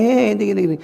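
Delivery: high cooing hello; playful adult greeting child. Retold or imitated. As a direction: imitate fussing over a toddler. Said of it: "habis itu ketemu anaknya".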